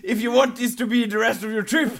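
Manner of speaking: loud, high, exasperated mock of a friend; hammed-up impatient yelling at a dawdling friend. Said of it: "I can still remember Jacob's face, like".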